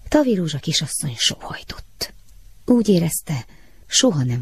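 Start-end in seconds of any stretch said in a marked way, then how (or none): none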